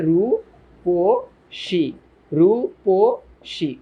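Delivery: রূপসী very slowly as "ru, po, shi"; syllable by syllable. Shown as a demonstration. Teacher demonstrating the middle-vowel rule, slow separated syllables. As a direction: stretch each syllable of রূপসী with pauses, middle "po". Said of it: "এই অ এর উচ্চারণ হলো হচ্ছে".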